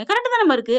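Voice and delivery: high-pitched disbelief; puzzled protest. Retold or imitated. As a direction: defend the milk. Son protests to mother. (ஆச்சரியத்துடன் மறுப்பு)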